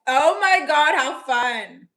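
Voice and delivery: loud high burst of delight; real excitement; excited. Real, not acted. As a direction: gush over how fun it sounds. Excited reaction to roast request.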